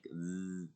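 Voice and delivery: "z" held as a long buzz; drawn-out voiced "z" as a demonstration; isolated Z sound. Shown as a demonstration. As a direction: let the tongue vibrate on a sustained "z". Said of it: "like this at the end".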